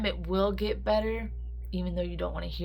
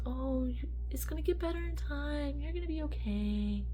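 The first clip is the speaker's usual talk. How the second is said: higher, sing-song imitation of empty comfort; mock sympathy; 9 semitones higher than usual. Mocking what people said after her daughter died, in mock sympathy. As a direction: parrot people's hollow reassurance mockingly. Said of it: "the one thing I hated".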